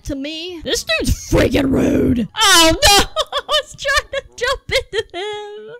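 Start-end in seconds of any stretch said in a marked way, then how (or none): 2.4-5.8 high wail breaking into laughter; laughing wail. Real, not acted. Gamer after a botched jump, laughing wail. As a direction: laugh helplessly while protesting.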